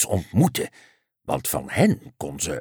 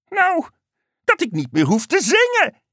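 high, delighted frog voice; cheerful frog. A narrator's reading. Frog answering the unicorn, cheerful and high. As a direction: explain happily in a high frog voice.

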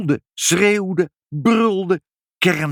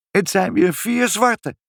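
high, slow little cat voice; cat's voice; 12 semitones higher than usual. A narrator's reading. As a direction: squeak it out slowly, very high. The cat Moertje announces her kittens.

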